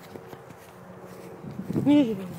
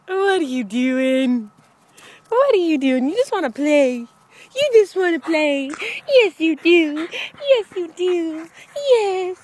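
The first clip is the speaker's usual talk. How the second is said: playful dog talk; 4 semitones higher, 7 dB louder than usual. Real, not acted.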